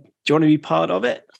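put-on quick, light Australian accent; hint of a mock Australian accent. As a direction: quote the invitation with an Aussie twang. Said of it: "Was that an Australian accent?".

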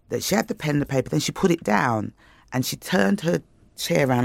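ordinary delivery

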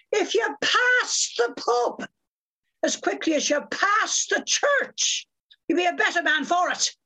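high, slow, declaimed Irish brogue; high-pitched theatrical Irish accent. Retold or imitated. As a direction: imitate a fiery Irishwoman's scolding, in a broad Irish accent. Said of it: "That's a brilliant accent".

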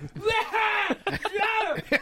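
high-pitched shouted mock cough; yell coughing. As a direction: imitate someone yelling out their coughs. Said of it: "just, like, just yell coughing".

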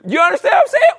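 high, loud emphatic call; loud high hype question; excited shout. Real, not acted. Shouting point to crowd.

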